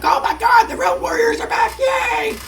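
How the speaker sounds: excited fans. Retold or imitated. Fans cheer the returning Road Warriors, high excited shouts. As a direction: squeal like a fan seeing heroes return. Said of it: "the fans are like".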